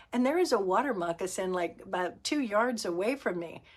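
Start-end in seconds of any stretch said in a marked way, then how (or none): none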